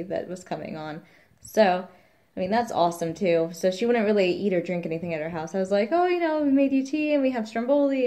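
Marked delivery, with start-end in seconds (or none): 5.9-8.1 high, bright offering voice, pitched up; sweetly pushing food on a guest; eager hostess offer. Retold or imitated. To the midwife, offering tea and Stromboli, in a high eager voice.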